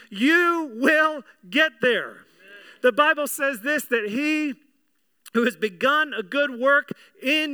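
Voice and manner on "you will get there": "You will get there" drawn out, word by word; "You will get there" with deliberate spacing; emphatic assurance. Real, not acted. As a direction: land slowly and firmly on "You" and "will". Repeats the promise for emphasis.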